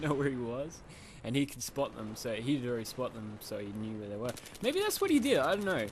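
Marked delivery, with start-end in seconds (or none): 4.6-5.9 high, loud excited guess. Real, not acted. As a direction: burst out with the guess. Excited guessing about the player.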